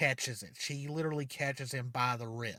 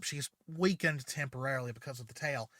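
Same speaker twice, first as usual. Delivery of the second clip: plain reading voice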